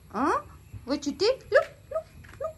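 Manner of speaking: high pet-scolding voice. Real, not acted. Scolding the dog.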